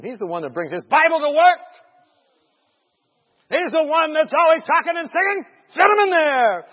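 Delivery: retold officers volunteering someone loudly; calling out. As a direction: pitch it up and call it out. Department sends him in, acted.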